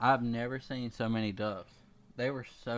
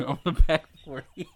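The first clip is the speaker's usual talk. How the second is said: laughing; 6 semitones higher, 5 dB louder than usual. Real, not acted. Laughing repeat of friend's phrase.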